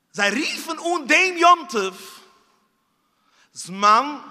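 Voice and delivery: loud, high, slow proclamation; preacher proclaiming the holiday name. Acted. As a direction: call it out to the room.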